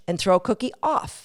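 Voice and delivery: normal talking voice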